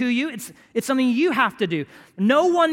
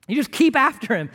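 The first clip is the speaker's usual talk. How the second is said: rising, louder preaching push; fervent preacher pressing the crowd; 4 semitones higher, 5 dB louder than usual. Acted. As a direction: drive it home from the pulpit. Preaches at full force.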